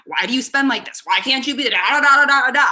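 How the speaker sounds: mocking husband's complaint; mock nagging husband. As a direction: imitate him scolding about spending. Mimicking husband's money complaints.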